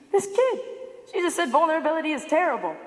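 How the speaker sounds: high-pitched scoffing disbelief; mock incredulity. Speaker voicing doubting audience members' thoughts, mock incredulity. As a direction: start high, then rush on, as if disbelieving.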